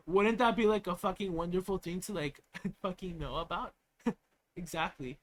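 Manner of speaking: ordinary talk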